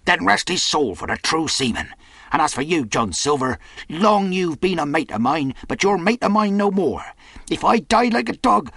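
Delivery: a hero defying the murderer; defiant outburst. Acted. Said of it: "Tom flashed out like a hero".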